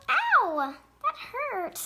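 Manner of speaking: high, loud "ow"; pained yelp. Pretend. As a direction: yelp as if bumped. Pretend knock while starting to host.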